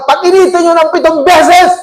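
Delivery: acted-out enraged command; furious king. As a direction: bellow the order like an enraged king. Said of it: "Galit na galit".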